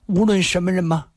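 high, light questioning voice; questioning woman. A narrator's reading. Woman asking her protector for assurance, delicately. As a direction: ask it high, seeking assurance.

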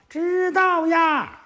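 put-on high, slow, sing-song girlish reply; coy palace maid. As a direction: drag it out like a giggly maid. Palace maids answer drawn out. (宫女拉长声调娇滴滴地回答)

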